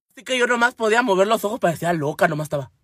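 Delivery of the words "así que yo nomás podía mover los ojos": ordinary delivery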